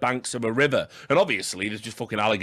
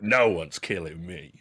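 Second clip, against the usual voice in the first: quoted tough guy; 8 semitones lower, slower than usual. Retold or imitated. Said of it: "it's a hell of a clip".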